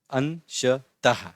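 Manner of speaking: "अंशतः" slowly in three pieces, final h released; syllable by syllable. Shown as a demonstration. Teacher introducing "अंशतः", syllable by syllable. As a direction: break "अंशतः" into syllables with short gaps.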